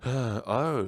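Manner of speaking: ordinary talk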